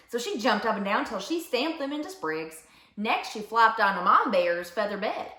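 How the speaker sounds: ordinary delivery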